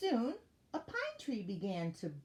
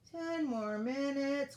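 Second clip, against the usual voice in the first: slow, drawn-out higher grumble; grumbling hare; 7 semitones higher, 3 dB louder, much slower than usual. A narrator's reading. Sleepy hare putting off the elf, slow grumbling. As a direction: drag it out, reluctant to get up. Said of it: "grumbled the hare".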